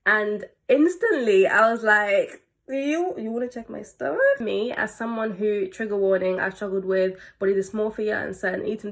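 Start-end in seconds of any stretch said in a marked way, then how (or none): 2.7-4.4 high-pitched questioning disbelief; retold startled query; taken aback. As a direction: ask it as if you can't believe the request. Retells instant reaction to request.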